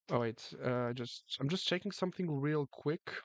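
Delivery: ordinary talk, nothing marked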